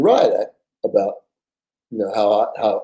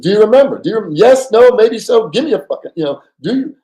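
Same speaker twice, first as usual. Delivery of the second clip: excited pressing; 9 semitones higher, 7 dB louder than usual. Retold or imitated.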